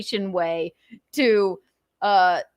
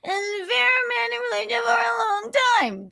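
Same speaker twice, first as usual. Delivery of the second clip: high, fast mocking echo of his words; high mocking parody of the ex's line. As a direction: parrot him back in a silly high squeak. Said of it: "How'd that work for ya?".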